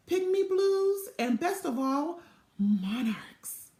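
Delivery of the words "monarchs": hushed, breathy wonder; awed whisper. A narrator's reading. Narrator revealing the best butterfly of all, in a whisper. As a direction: whisper it like a secret treasure.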